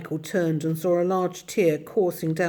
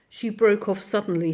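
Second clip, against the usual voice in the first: plain reading voice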